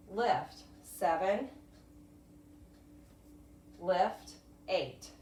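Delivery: ordinary delivery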